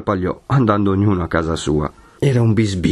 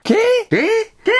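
very high, sharp questioning cries; startled crowd; much slower than usual. Acted. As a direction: react in alarm, one after another. Crowd reacts to alarming news. (esclamazioni allarmate, acutissime)

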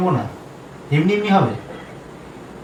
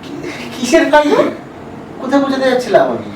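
much higher, startled denial; caught off guard; 12 semitones higher than usual. Acted. Husband caught lying by his wife, high startled denial. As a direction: squeak out an innocent denial.

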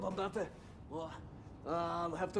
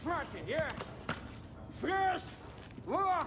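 shouting orders; 9 semitones higher than usual. Acted. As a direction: bark the orders over the noise. Medic shouts orders in chaos.

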